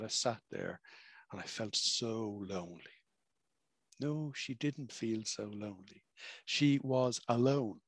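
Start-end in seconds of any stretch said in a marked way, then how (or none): 0.0-3.0 soft sad quote. Retold or imitated. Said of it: "And she said".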